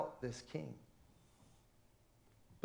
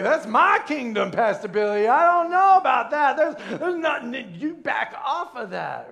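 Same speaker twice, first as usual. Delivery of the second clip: loud, higher protest; mock indignant objection; 6 semitones higher, 8 dB louder than usual. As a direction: defend your turf against the pastor. Congregant objecting to pastor, acted.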